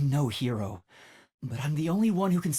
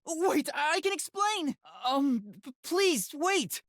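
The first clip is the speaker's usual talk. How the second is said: put-on much higher, pleading girl's voice; 14 semitones higher, slower than usual. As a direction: beg her to wait in a high girlish voice. Pleading to be heard.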